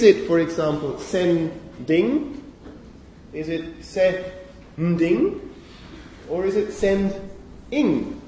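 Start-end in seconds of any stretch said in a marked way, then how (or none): 1.0-2.4 "sending" split as "sen", pause, "ding"; syllable break. Shown as a demonstration. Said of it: "what the boundaries are between those syllables". 3.8-5.4 "se-nding" slowly split as "se", pause, "nding"; syllable break. Shown as a demonstration. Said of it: "what the boundaries are between those syllables". 6.7-8.2 "send-ing" slowly split as "send", pause, "ing"; syllable break. Shown as a demonstration. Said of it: "what the boundaries are between those syllables".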